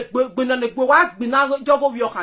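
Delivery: ordinary talk, nothing marked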